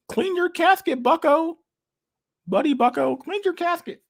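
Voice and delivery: high, strained parody voice; hammed-up high parody; mocking impression. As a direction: squeak out a smug pundit's lecture. Mock pundit catchphrase voice.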